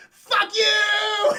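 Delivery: sudden high, loud screaming curse; voice changing. Retold or imitated. The Diddler screaming at Batman as he falls, high loud scream. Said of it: "the Diddler's voice changes".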